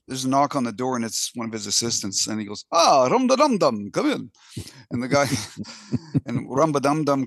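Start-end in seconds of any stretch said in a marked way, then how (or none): 2.7-4.3 mock accent Pope. Pope calling his assistant in, in a high put-on accent. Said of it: "I'm gonna do an accent too".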